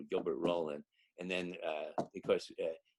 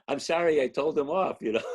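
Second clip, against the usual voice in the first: said through laughter, louder and higher; laughing; 7 semitones higher, 9 dB louder than usual. Real, not acted. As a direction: laugh while you admit it. Laughing at own story.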